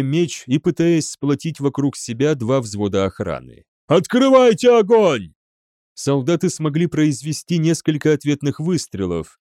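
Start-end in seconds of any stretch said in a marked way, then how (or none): 3.9-5.3 very high, loud yell; shouted command. A narrator's reading. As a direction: bellow at the troops to shoot. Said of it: "закричал генерал Деф". (во всё горло орать приказ)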